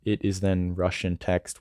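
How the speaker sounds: ordinary delivery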